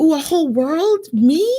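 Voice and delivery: put-on flattered girl.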